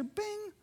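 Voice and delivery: a high, soft phone notification bing. Retold or imitated. Preacher imitating a phone's text alert with a high bing.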